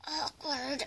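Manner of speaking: ordinary talk, nothing marked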